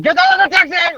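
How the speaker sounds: high, loud cab driver yell; shouting. Retold or imitated. Cab driver to passed-out drunks in his taxi, shouting. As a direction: yell to rouse sleeping passengers.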